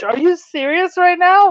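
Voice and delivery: high-pitched, appalled disbelief; incredulous. Retold or imitated. Podcaster reacting to the man who refused candy, high and incredulous. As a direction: recreate your disbelief, pitch jumping high. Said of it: "It was so ridiculous".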